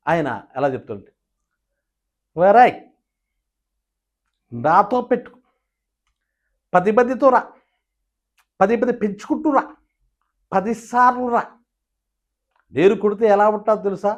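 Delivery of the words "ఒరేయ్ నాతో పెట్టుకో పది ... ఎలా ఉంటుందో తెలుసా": light, high hero voice; ANR impression. Mimic doing Nageswara Rao's light-voiced version of the threat. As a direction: keep it light and lilting.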